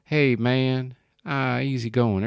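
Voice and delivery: hammed-up American South drawl; Southern US drawl. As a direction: stretch it out like a stoned Southern US guy. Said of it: "kinda like sort of drawling".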